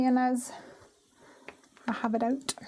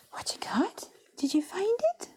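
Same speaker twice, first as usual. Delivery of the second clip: whisper to puppy; 10 semitones higher than usual. Real, not acted.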